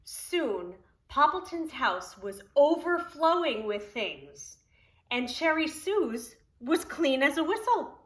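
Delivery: lively, sing-song storybook reading; reading to children. Acted. Preschool teacher reading Poppleton to children, storybook voice. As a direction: read it aloud to the preschool class. Reads picture book aloud.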